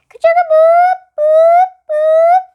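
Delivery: very high, loud mechanical bell chant; bell sound. A narrator's reading. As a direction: ring it out like an alarm.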